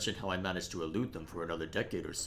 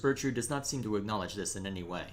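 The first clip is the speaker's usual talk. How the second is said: ordinary delivery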